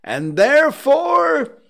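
very high, loud, slow cry; climactic proclamation. Acted. Scrooge builds to his surprise for the clerk, booming. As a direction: shout THEREFORE high, falling at the end.

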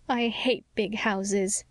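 slow, tearful and a little broken; a touch of sobbing, drawn out. Acted. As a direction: say it like a hurt child near tears. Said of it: "sobbed Anne".

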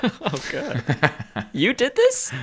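startled high-pitched disbelief; shocked. Real, not acted. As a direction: squeal it in disbelief. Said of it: "mock horror at rats".

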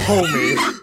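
high, loud, drawn-out homie; a dramatic offended homie; reacting to the Spotify hater; mock reaction. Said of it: "I was like".

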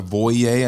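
"Voyeur" said as "voy-AY"; unsure pronunciation. Real, not acted. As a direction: say "Voyeur" with an "ay" ending, slowly. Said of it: "however you pronounce it".

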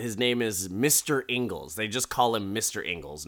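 ordinary talk, nothing marked

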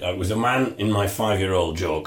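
usual speaking voice

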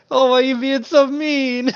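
high whiny protest; mock whiny. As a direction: whine it like you're hurt. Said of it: "And I'm like".

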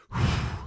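long breathy exhale; big relieved sigh, reliving the moment; sigh of relief. Retold or imitated. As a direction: blow out the air in relief. Recalls how relieved he was.

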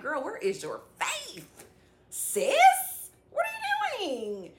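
high, drawn-out incredulous questioning; playful scolding. Acted. Scolding a sister about her faith, playful high disbelief. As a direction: stretch it out in mock disbelief, pitch climbing.

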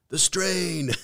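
high-pitched panicked wail, hammed up; comically overwrought high cry; mock freaking out. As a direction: cry it out in playful, exaggerated panic. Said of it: "They're freaking out".

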